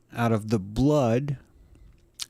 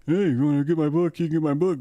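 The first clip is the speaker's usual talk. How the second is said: quick, slightly higher sales pitch; playful fast huckster patter; mock plug; 5 semitones higher, faster than usual. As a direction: toss out a hurried fake book plug. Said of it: "that would be a little plug".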